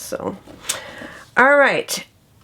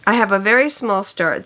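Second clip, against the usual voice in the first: ordinary delivery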